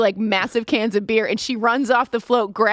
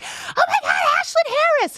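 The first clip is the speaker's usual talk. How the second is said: excited fangirl shriek. Retold or imitated. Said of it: "I was like".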